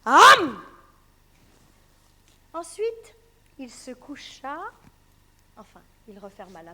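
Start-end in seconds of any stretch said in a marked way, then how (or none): 0.1-0.6 shouted gobbling sound; loud chomp. Acted. Storyteller acting the wolf's big bite. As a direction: snap it like a wolf bite. Wolf eats grandmother.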